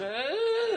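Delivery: high, rising squeak; creaking door imitation. Sleepless traveller mimicking the noisy door, high drawn-out squeak. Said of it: "the door's going".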